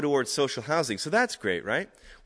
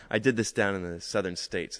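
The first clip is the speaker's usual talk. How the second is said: ordinary talk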